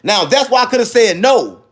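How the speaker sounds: usual speaking voice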